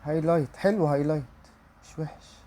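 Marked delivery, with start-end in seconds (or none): 1.8-2.4 breathy whisper; quiet real aside. Real, not acted. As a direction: say it under your breath. Muttered reaction to pull.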